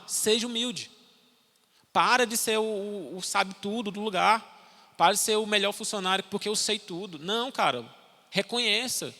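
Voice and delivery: pregação inflamada. Real, not acted. Exortação enfática do pastor.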